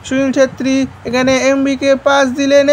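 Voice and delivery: ordinary talk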